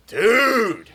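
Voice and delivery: loud, high, drawn-out hail; awestruck greeting. Retold or imitated. Imitating lookalike greeting last night's hero, awestruck. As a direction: hail him like a legend. Admirer greets him next morning, retold.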